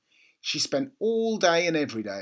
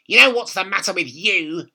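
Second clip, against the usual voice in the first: put-on higher, louder bossy old woman; bossy grandma; 6 semitones higher, 10 dB louder than usual. A narrator's reading. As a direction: order the boy about. Grandma voiced in read story.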